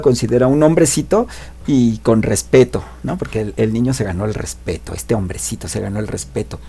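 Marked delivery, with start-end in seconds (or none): none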